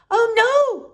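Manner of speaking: high, loud "oh no" of alarm; dismayed cry. Acted. Boy crying out as his glasses break. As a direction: yelp as the glasses crunch.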